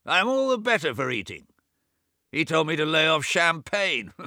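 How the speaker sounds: put-on high, loud, testy old man in bed; a cranky old man scolding his brother; irritable old invalid. Bedridden Swithin chiding his deaf twin, high and testy.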